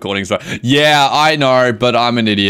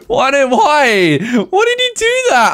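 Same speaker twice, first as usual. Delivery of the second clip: high, laughing half-sob; laughing cry; 4 dB louder than usual. Real, not acted. Streamer wailing over her lost pet, laughing sob. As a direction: wail in laughing disbelief.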